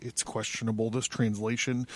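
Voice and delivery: muttered remark about the translation; quiet aside. Real, not acted. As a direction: step out of the reading and murmur a doubt.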